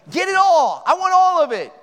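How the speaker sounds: loud, high robber's shout; retold yell. Robber pushing his victim along upstairs, acted yelling. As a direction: shout it as an imitated order. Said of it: "he's yelling at me".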